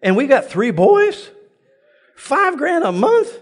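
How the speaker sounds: high, slow disbelief at the cost; incredulous. Retold or imitated. Preacher recalling the rent, slow high disbelief. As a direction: stretch out the shock of the price.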